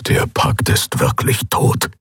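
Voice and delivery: slow, voiceless muttered despair; muttered low. Acted. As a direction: mutter it under your breath, defeated. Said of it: "brummte Skoru neben ihr".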